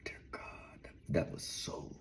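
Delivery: breathy hushed whisper after a burp; soft unvoiced mutter; whispered aside. Real, not acted. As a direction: whisper it to yourself, savouring.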